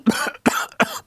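a dry, breathless cough; short unvoiced cough from the smoke; coughing fit. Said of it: "rief [name] hustend".

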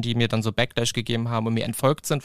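normal talking voice

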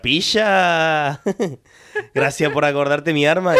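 put-on loud, high Sevillian accent; a playful put-on Sevillian accent, slow on the thanks. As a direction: imitate a Sevillian friend on the phone in a Seville accent. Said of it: "eso es sevillano".

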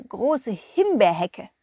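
ordinary delivery, nothing marked

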